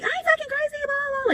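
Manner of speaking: very high, held-in mock screech; mock yelling. Wife yelling back at being called crazy, mock screech. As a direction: imitate her yell way up high without volume. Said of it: "Like, just yelling".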